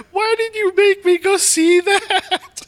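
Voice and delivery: high, loud mock wail through laughter; slow, hammed-up tearful cry, laughing; retold crying out. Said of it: "I heard her cry out".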